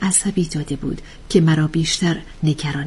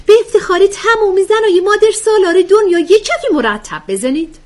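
loud, high call for applause; mock cheerleading; 7 dB louder than usual. Joker interrupting the reading to demand applause, mock-rousing. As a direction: whip up the crowd with a high, loud shout.

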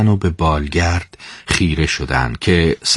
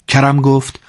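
plain reading voice

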